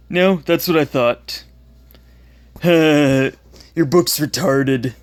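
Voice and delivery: taunting schoolyard bully voice; mocking bully. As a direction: sneer it like a bully. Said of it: "the ones that I, I quoted".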